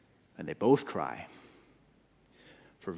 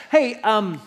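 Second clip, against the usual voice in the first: put-on much higher, louder girl's voice; accusing servant girl; 12 semitones higher, 9 dB louder than usual. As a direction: point at him and call him out. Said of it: "And she says".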